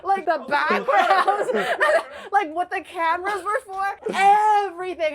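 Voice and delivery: laughing while she lists it all; laughing recap. Real, not acted. Laughing as she tells the host about her parents.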